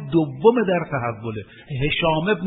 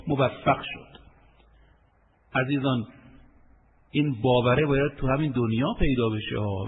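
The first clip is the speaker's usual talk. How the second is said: normal talking voice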